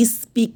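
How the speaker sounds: স্পিক with an extra vowel in front, "ispeek"; mispronounced speak. Shown as a demonstration. Teacher warning students off a mistake, mispronounced speak. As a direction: wrongly start স্পিক with "i" before the s. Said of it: "স্পিক না".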